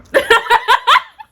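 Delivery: loud high laugh; high burst of laughter. Real, not acted. Laughs at own joke.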